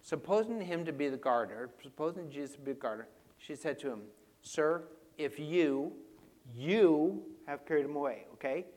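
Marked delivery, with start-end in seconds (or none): 4.4-8.3 YOU stretched out and stressed; emphatic. Acted. Mary addressing the supposed gardener, emphatic stress on YOU. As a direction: lean hard and slowly on YOU. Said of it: "It's emphatic".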